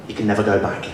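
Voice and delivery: normal talking voice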